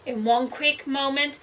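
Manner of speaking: plain reading voice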